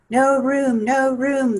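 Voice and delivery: loud chorus of no room; crying out. Acted. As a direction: call it out in a crowded chorus. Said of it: "they cried out".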